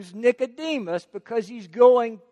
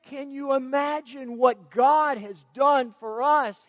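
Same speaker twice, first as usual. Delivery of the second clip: ordinary talk, nothing marked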